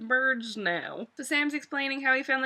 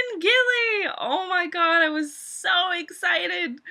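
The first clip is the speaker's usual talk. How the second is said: squealing high and loud; bursting with delight; high, loud happy shriek; real excitement; 7 semitones higher, 5 dB louder than usual. Real, not acted. Thrilled at the surprise reunion.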